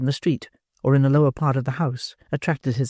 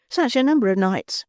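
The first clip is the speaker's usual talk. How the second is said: higher, tender woman's voice; 9 semitones higher than usual. A narrator's reading. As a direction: soften it with sweetness. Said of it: "with the touch of woman's tenderness".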